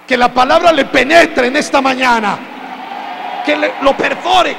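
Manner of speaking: loud preaching climax; full-force preaching. Real, not acted. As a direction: blast it over the congregation. (predicación a todo pulmón)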